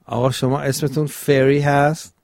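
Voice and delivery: ordinary talk, nothing marked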